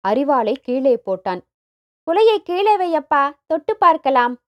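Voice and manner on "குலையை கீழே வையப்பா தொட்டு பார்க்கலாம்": put-on high, excited child voice; jumping, eager children. A narrator's reading. Children beg father to let them touch the bunch, excited. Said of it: "என்று குதித்தன குழந்தைகள்".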